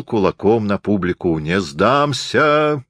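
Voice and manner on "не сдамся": high, long-drawn theatrical declaration; cheerful cry. A narrator's reading. Stage Napoleon defies the audience, crying out cheerfully and slowly. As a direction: cry it out merrily, stretching the words. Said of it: "крикнул он весело".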